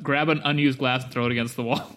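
normal talking voice

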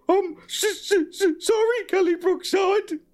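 high, squeaky stuttering apology; hammed-up high-pitched nervous stutter; stammered feeble apology. A narrator's reading. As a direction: stammer feebly, stretching the final words. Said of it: "stammered a feeble apology".

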